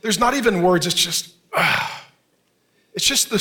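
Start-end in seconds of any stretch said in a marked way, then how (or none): 1.5-2.0 wordless sigh of pain; demonstrated soul-deep sigh. Shown as a demonstration. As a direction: let out one heavy, deep breath. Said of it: "That's a sigh".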